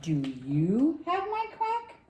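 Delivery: slow, high duckling question; drawn-out high baby-duck voice; little duckling. A narrator's reading. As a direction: stretch it out, swooping up high. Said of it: "Little Duckling asked the sheep".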